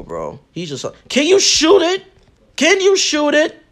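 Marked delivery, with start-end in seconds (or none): 1.1-3.6 high, loud angry shout at the opponent; real frustrated yelling. Real, not acted. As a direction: demand it loudly, fed up. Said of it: "I'm getting mad".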